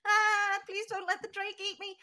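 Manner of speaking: stammering apology rising to a high, loud squeak; a terrified droid begging not to be eaten; scared robot. Acted. BB pleading with Charlie not to feed him to the drake, rising to a panicked squeak. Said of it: "looks at Charlie and goes".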